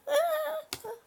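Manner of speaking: real high-pitched squeal of laughter. Real, not acted. Laughing at her cat on camera, high squealing laugh. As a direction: let a squeaky laugh burst out.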